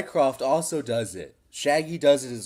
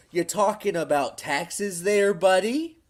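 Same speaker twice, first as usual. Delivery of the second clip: higher, rising surfer-bro question; lightly done surfer impression; 6 semitones higher, 3 dB louder than usual. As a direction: slip into the streamer's buddy voice. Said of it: "I'll do the voice".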